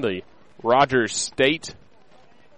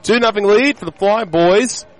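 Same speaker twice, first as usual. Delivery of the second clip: excited announcer; 8 semitones higher, 5 dB louder than usual. Real, not acted. Inning recap after break.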